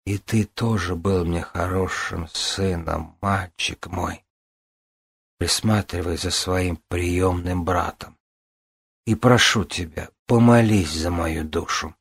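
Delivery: slow, faltering, breaking voice. Acted. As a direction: voice cracking, barely holding on. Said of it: "срывающимся голосом произнесла".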